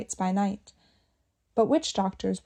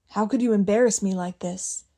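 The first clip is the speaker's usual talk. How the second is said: plain reading voice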